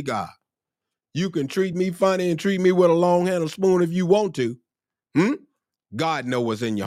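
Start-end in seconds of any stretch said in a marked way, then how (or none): 1.1-4.6 high, sustained exhortation; impassioned preaching. Real, not acted. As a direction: preach it high in one driving run. Preacher exhorts at full pitch.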